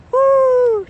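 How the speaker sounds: loud, high whoop of relief; excited whoop. Real, not acted. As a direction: cheer it out like a small win. Barely lifts wheel overhead.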